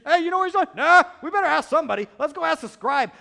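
high, loud comic back-and-forth; hammed-up puzzlement; clueless exchange. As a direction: call out like baffled officials. Acted exchange among puzzled priests.

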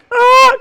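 high loud startled ah; mock shocked cry. Preacher imitates a churchgoer crying out in shock. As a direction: yelp it out in shock. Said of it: "He went".

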